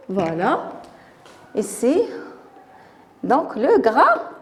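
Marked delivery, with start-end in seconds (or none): none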